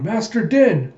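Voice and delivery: high, loud cry; calling out. Retold or imitated. Koyomi watches the fight, high loud call to Den.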